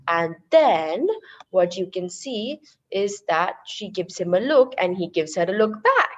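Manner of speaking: ordinary talk, nothing marked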